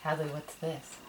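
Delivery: ordinary delivery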